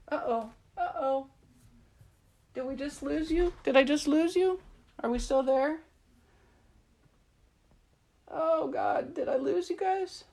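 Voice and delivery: high, worried calling out; real alarm. Real, not acted. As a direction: check anxiously if anyone is still there. Livestream seems to have dropped.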